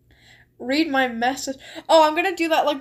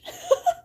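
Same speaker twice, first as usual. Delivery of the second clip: short high burst of giggling; squeaky laugh; high giggle. Real, not acted. Laughs after tease.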